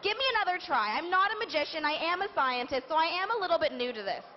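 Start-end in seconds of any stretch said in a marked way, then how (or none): none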